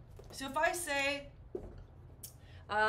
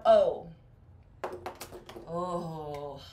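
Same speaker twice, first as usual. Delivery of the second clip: ordinary talk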